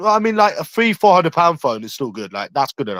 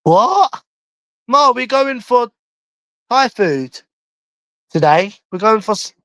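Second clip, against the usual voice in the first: raised call to mum; 7 semitones higher than usual. Real, not acted. Son answering his mum from another room, high raised call. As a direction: call out so mum can hear you.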